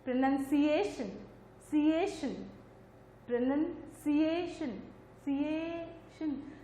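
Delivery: सिएशन pulled out and stressed, slow; slow syllable-stress demo of pronunciation. Shown as a demonstration. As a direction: isolate सिएशन and lean on it. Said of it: "जैसे pronunciation".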